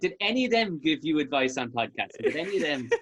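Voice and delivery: high laughing mock question; laughing mockery. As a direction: tease through a laugh. Mocking the other guests.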